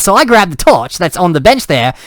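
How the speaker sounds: normal talking voice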